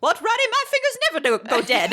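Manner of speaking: high, light Pamela voice, laughing. Retold or imitated. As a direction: play her as breezy and proud. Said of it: "she's like".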